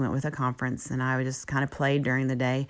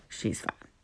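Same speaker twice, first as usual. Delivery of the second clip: "She's fine" breathed out as a soft whisper, much quieter; whispered repeat; 9 dB quieter than usual. Retold or imitated. Repeated under her breath.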